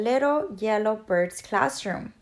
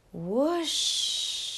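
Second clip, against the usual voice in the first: soft, long airy whoosh; wind whoosh; 2 semitones higher, 6 dB quieter than usual. A narrator's reading. Narrator imitating the cold autumn wind, softly whooshing. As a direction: blow out a sustained rushing sh. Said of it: "the sound of the wind".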